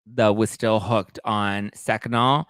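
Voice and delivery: usual speaking voice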